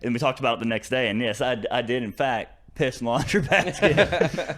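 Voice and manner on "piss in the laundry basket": slow, laughing confession. Real, not acted. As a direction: admit it through a laugh.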